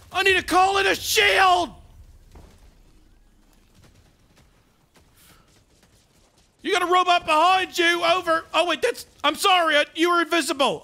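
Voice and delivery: loud, urgent military radio voice; Sarge. Acted. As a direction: call for backup like a frantic sergeant.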